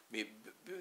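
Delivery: b, b said soft with barely any voice; b, b muttered softly; quiet consonant sound. Shown as a demonstration. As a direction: breathe out b, b to show it makes no sound. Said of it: "ça sonne pas".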